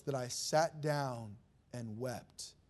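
lent et plus doux, une pointe de chagrin; mild sorrow. Acted. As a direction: laisser peser les mots, lentement. Reading of weeping and mourning.

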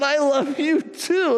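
put-on high, slow child's voice; a small boy saying it tenderly; little boy voice. Grandson answering grandpa in a high, slow child voice.

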